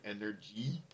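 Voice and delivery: "Energy" as EN-er-JEE, soft G; standard English pronunciation. Shown as a demonstration. Comparing with the original word, soft-G "Energy" said questioningly. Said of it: "what it originally sounds like".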